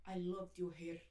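put-on low, soft Russian-accented English. Russian shop owner compliments a customer's hair, imitated Russian accent. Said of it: "eu imitava o sotaque".

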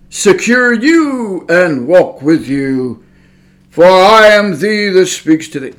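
put-on very slow, solemn old-English voice; King James God voice. Mocking how people imagine God talks, solemn. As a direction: intone it like scripture. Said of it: "talk to you like that".

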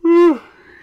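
big open tired yawn; loud yawn. Real, not acted. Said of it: "I'm really sorry that I'm yawning".

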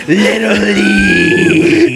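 very high, loud, drawn-out screech; full-volume mock tantrum yell; imitated customer's shout. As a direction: scream it with the last word held long. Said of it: "how I'm saying it is how he said it".

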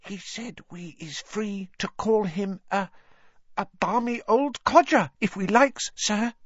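high, nervous elf stage whisper; mock whisper. A narrator's reading. Dobby tells Harry Dumbledore's secret, nervous stage whisper. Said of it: "Dobby whispered".

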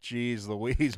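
slow, hammed-up Wisconsin accent; playful mock Wisconsin exclamations. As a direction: put on a broad Wisconsin accent, drawing out the vowels. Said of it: "If I go to Wisconsin for three days".

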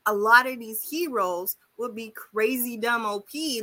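ordinary delivery

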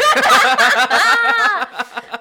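loud, high burst of real laughter; big laugh. Real, not acted. Laughing hard as the host reacts. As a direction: crack up at the reaction.

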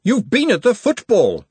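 high, loud scolding cook; snapped. A narrator's reading. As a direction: snap an accusation at him. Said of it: "snapped Glenda".

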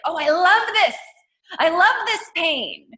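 high, loud, emphatic mock delight; exuberant loving. Guest acting out loving the tough stuff, exuberant. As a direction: embrace the pain with gusto, punching it out. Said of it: "it's like loving the tough stuff too".

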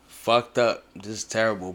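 normal talking voice

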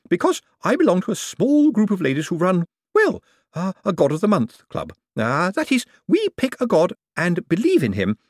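high, loud eager babble; nervous lady. A narrator's reading. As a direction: pour it out high and keen. Lady nervously pitches club.

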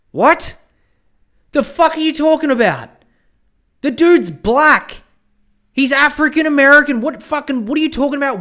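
high, loud, incredulous rant; outraged disbelief. Real, not acted. Podcaster reacting to an article, loud outrage. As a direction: blow up in disbelief.

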